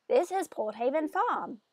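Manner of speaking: slow put-on townsperson voice; slow, deliberate NPC voice; different character voice. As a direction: announce the farm slowly in a new character voice. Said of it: "I've already done a different voice".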